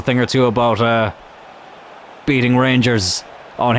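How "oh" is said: normal talking voice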